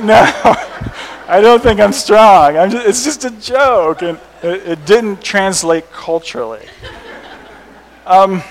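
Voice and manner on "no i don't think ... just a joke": higher, louder, drawn-out protest. Retold or imitated. American explaining his misread joke to a classmate, in laughing protest. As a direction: insist through your own laughter, slowing it down. Retold embarrassed protest to friend.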